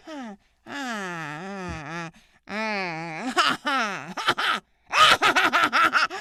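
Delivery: strange halting laugh growing into loud cackle; character laugh building. Shown as a demonstration. Showing the host how Vicky's laugh was found, building cackle. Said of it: "I was like".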